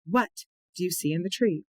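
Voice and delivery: "what" set apart and emphasized before the question; teacher's cue with an emphatic "what"; stress on what. Shown as a demonstration. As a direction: punch "what", then finish the question. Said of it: "Notice how I emphasize the word what".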